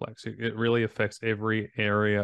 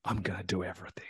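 quick excited whisper, imitating the client; whispered quote; 2 semitones lower, 9 dB quieter, faster than usual. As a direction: hiss it out fast and eager. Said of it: "she was like".

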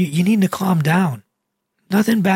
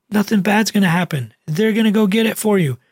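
ordinary talk, nothing marked

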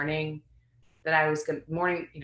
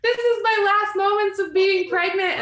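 high, tearful, breaking voice; crying; 9 dB louder than usual. Retold or imitated. Mother weeping with her kids over the pregnancy ending, high and teary. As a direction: let the tears push the pitch way up. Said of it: "then I started crying".

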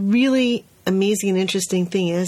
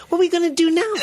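put-on higher, bright toddler voice, all better; cheerful little boy; 11 semitones higher, much faster than usual. Teacher voicing the boy recovered after his cry. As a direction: chirp it like a kid who's fine now. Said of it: "looked at me and said".

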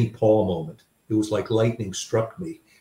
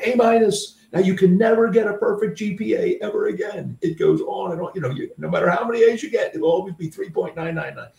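harsh critical father; 10 semitones higher than usual. Retold or imitated. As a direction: rant like a disappointed Marine dad. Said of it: "I was expecting him to go".

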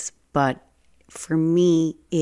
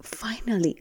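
high, very slow whispered relief; imitating the teacher's stunned whispered relief; relieved whisper; 8 semitones higher, 3 dB quieter, much slower than usual. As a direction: breathe it out as if finally relieved. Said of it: "she looked at me like".

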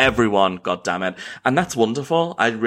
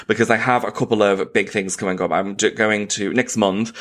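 usual speaking voice

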